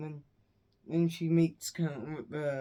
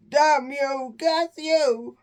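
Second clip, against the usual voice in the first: loud, high-pitched mock curse; maledizione recitata; 9 semitones higher, 9 dB louder, much slower than usual. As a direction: hurl a hammed-up villain's curse. Villain curses in retold plot.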